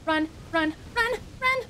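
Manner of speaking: repeated calls jumping up to high yelps; panicked fleeing. Real, not acted. Fleeing a boss in game.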